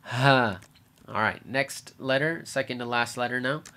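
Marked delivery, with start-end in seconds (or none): 0.0-0.6 "ha" breathed out from the chest; one falling, sigh-like "ha"; exaggerated sighed H. Shown as a demonstration. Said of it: "One more time".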